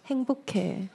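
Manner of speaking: ordinary talk, nothing marked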